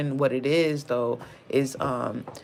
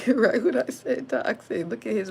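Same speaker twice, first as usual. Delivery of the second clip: laughing; 9 semitones higher than usual. Real, not acted. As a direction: laugh through the words as you repeat them. Laughs while repeating toxic.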